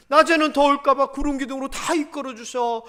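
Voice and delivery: ordinary talk, nothing marked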